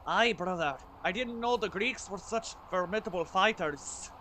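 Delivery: a hint of Scottish brogue as Mimir; Scottish accent. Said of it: "That's my best Scottish accent".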